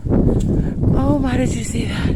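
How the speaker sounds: sudden high, loud "oh my" at the birds; startled delight, pitch jumps up; surprised. Real, not acted. Sudden sight of doves.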